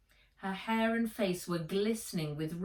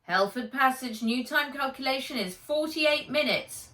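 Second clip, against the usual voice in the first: higher, lightly robotic report; robot navigator; 7 semitones higher, 5 dB louder than usual. Acted. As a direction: read out the figures like a ship's computer. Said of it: "said Fizz".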